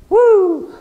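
high, loud mock whoop of carefree cheer. The pastor mocking a careless believer with a loud whoop. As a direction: whoop it up like you've got no cares.

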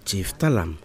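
ordinary talk

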